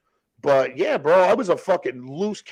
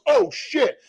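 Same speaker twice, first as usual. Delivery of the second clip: startled high-pitched outburst, imitated; alarmed dad; 14 semitones higher than usual. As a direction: blurt out a retold yelp of alarm. Retelling dad's shock at gun.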